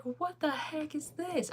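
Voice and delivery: high-pitched mock bafflement; retold disbelief. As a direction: act out being thrown, pitch jumping up. Retells reaction to the wrong version.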